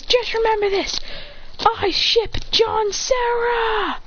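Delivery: high call, ending drawn out; parting cry. Acted. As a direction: proclaim it high, stretching the names. Acted parting cry when blasted away.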